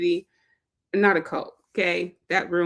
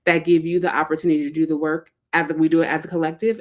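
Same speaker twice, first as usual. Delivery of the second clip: usual speaking voice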